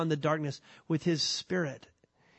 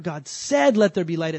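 "said" stretched and pitched up; stress on SAID; 5 dB louder than usual. Shown as a demonstration. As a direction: lean hard on the word "said". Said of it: "put the emphasis on the wrong word".

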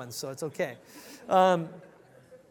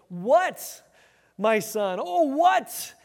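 pleading cry; 6 semitones higher, 7 dB louder, much slower than usual. Shown as a demonstration. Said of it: "the actual more literal word for word translation says".

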